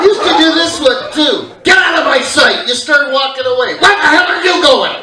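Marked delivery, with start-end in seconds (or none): 1.6-2.7 loud, high mock dad shout; dad yelling. Angry dad loudly banishing his son. 3.7-4.9 loud high mock dad yell. Contradictory dad loudly calling his leaving son back.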